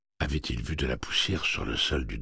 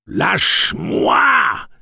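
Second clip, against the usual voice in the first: high, very slow and loud pained scream; first pained scream of Let go; 12 dB louder, much slower than usual. A narrator's reading. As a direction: howl at him to let go. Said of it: "hurla Slightman".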